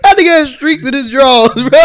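laughing. Real, not acted. Laughing at friend's story.

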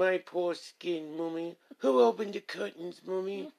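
high mock English child voice; English accent. Child asking Mommy about the curtains, in a put-on English accent. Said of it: "I was doing my English accent".

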